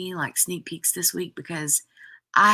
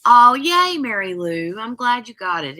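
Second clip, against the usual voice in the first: ordinary talk, nothing marked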